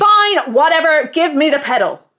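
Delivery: high, loud angry snap; fed up, snap it out; furious giving in. Acted. Furious customer gives in.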